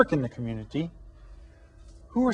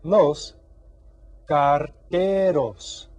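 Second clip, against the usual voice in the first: "carteros" very slow and clearly spoken; slow model; 4 dB louder, much slower than usual. Shown as a demonstration. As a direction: draw "carteros" out for learners. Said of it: "these people are called".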